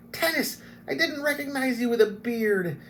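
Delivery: high excited exclamation; delighted kender. Acted. Kender greeting Tanis, high and thrilled. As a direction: burst out with glee at the beard.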